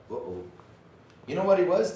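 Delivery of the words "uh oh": muttered uh-oh. Retold or imitated. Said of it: "was like".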